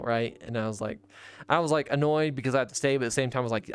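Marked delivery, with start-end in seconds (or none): none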